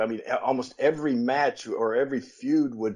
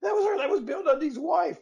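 a mock gasp of shock, pitched much higher; shocked surprise. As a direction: act stunned, voice jumping way up. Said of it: "And I said".

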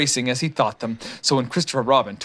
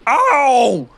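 loud, higher yelp; shouted; 11 semitones higher, 7 dB louder than usual. A narrator's reading. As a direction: yelp as he falls. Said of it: "he shouted".

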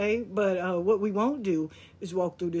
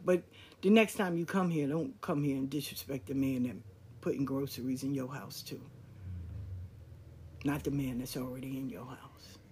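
soft and even, never raising the voice; calm, not raising her voice; 5 semitones lower, 7 dB quieter than usual. Retold or imitated. Mother calmly setting her sister straight, soft and even. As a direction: put her in her place quietly, no heat at all. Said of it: "That's how my mother would talk. She didn't raise her voice. She was very calm".

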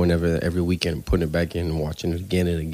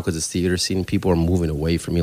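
usual speaking voice

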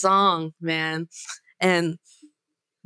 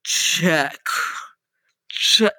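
lightly imitated mic check; terse "check", pause between each; sound guy testing mic; 5 semitones lower than usual. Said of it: "suddenly we hear".

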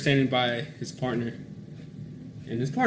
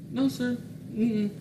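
higher-pitched "who, me?" denial; retold innocent denial; 11 semitones higher, slower than usual. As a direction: act out a high, innocent reply to an officer. Acted meek answer to officer.

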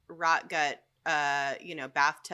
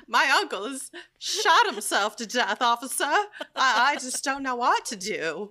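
put-on high, loud, crying old-movie plea; melodramatic sobbing plea; 9 semitones higher, 5 dB louder than usual. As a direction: sob it to the officer. Acting out a sobbing call.

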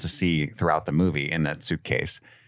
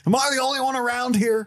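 mock John Goodman. Co-host continuing the Big Lebowski bit, high mock rant. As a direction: bellow it as a silly parody. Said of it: "John Goodman version".

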